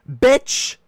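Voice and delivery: yelled high and loud; angry outburst. Acted. Ranting at the audience about fry thieves, a loud angry yell. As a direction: explode at the fry thief.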